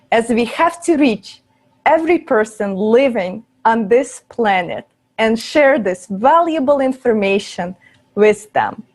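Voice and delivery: ordinary talk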